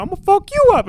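loud, high-pitched parrot echo; mimicking parrot. Parrot talking back to the brother, loud high squawk. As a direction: screech it like a cheeky parrot. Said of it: "Paris be like".

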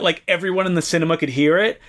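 ordinary delivery

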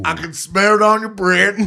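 mock him in a silly voice; put-on mocking voice.

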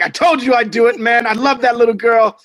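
high and loud, thrilled; excited boast. Retold or imitated. Telling the actor the news, loud and thrilled. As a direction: burst in bragging to a buddy.

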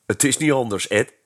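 ordinary delivery

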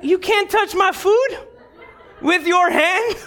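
high, incredulous, scolding at the counter worker; indignant complaint. Retold or imitated. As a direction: pastor reliving his hangry outburst at the Wendy's worker. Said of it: "I wasn't very loving".

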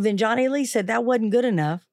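normal talking voice

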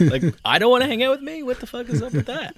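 very high-pitched comic disbelief; mock outrage. Rejecting himself and baffled by it, mock outraged. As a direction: escalate into squeaky, affronted protest.

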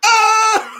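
high whoop into laughter; joyful shout and laugh. Real, not acted. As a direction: let out a happy yell, then laugh. Celebration bursts into laughter.

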